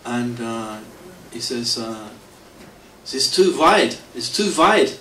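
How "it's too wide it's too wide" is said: a touch of a stern cop, raised voice; mock police warning, higher and louder; stern policeman. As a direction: insist like an irritated traffic cop. Policeman's complaint, retold.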